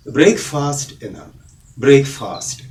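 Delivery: "ബ്രേക്ക്ഫാസ്റ്റ്" said brayk-FAAST; long vowels in both halves of "ബ്രേക്ക്ഫാസ്റ്റ്"; common mispronunciation. Shown as a demonstration. Teacher showing what many people say, mispronounced brayk-faast. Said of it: "അത് തെറ്റാണ്".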